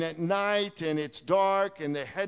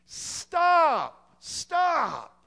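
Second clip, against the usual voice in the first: high, loud, drawn-out shouts; yelled warning; 12 semitones higher, 6 dB louder than usual. Acted. Preacher yells to halt the perishing, high urgent shout. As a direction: shout each word at full force, falling at the end. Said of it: "get on the bridge and yell".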